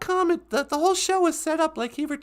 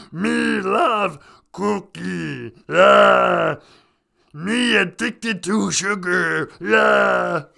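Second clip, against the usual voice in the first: loud, low, drawn-out monster voice; big growly puppet monster; Cookie Monster; 7 semitones lower, 7 dB louder, much slower than usual. Acted. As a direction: bellow it slow and deep. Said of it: "This is Cookie Monster".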